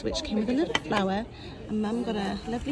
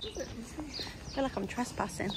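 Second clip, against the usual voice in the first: soft, furtive undertone; hushed; 8 dB quieter than usual. Real, not acted. Vlogger feels she's trespassing in a fancy venue, hushed. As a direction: keep it down like an intruder.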